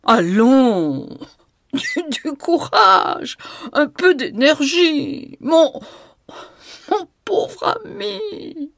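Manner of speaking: high, slow, laughter-choked words; choking with laughter. A narrator's reading. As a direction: gasp the words out between laughs. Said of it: "en étouffant". (en étouffant de rire, aigu et lent)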